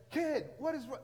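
high-pitched mock exasperation, cut off; acted fed-up priest; exasperated outburst. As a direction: burst out at the pestering boy. Priest woken a third time.

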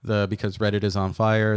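normal talking voice

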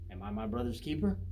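low, understated sarcasm with a rising end; sarcastic question. Acted. Cain answers the Lord after being corrected, quietly sarcastic. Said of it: "hear the sarcasm dripping from his lips".

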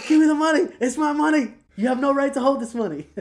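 high, loud demanding shout; mock furious yelling. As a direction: yell it like you're grabbing your money back. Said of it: "Max has got her in the fucking choke".

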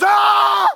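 very high, loud startled shriek; high, loud fright shriek; startled scream. Retold or imitated. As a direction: scream like someone just jumped out. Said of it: "He goes".

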